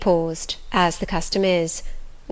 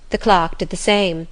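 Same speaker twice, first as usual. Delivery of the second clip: plain reading voice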